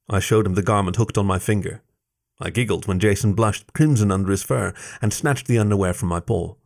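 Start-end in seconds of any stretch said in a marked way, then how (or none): none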